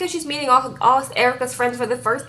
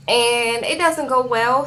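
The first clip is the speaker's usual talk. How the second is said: ordinary talk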